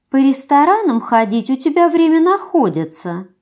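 high, teasing pout; pouty reproach. Acted. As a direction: sulk and needle him. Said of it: "Она капризно надула губы".

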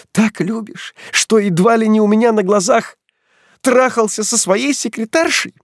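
high, loud biting sarcasm; mock his love; sarcastic accusation.

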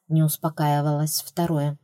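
ordinary delivery, nothing marked

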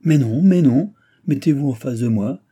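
high, loud, lightly acted objection; insistent protest. A narrator's reading. Sister stops the boy getting out, protesting insistently. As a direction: break in and overrule them. Sister cuts into quarrel, intervening.

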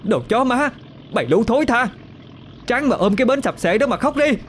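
very high-pitched, venomous tirade; contemptuous woman swearing at a crowd; foul-mouthed cursing. A narrator's reading. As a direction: hurl insults in a high, scornful voice. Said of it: "miệng văng tục liên hồi".

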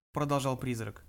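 plain reading voice

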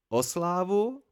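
"slávu" lifted high in a rising, disbelieving question; incredulous question. A narrator's reading. Scribe questions Kvothe's offer of fame, incredulous rising tone. Chronicler asks back about fame.

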